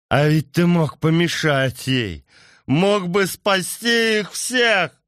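put-on high, loud accusing drunk yell; angry shout. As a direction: snarl the blame at the king. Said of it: "зарычал".

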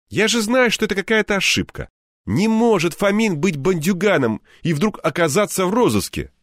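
high, cheerful buddy voice; a jolly friend brushing it off; friend Anton. A narrator's reading.

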